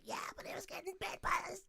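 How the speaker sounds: high, soft, giggly retelling; squeaky imitation of someone cracking up; imitated laughing. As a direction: act out getting carried away with mock laughter. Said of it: "like, really laughing".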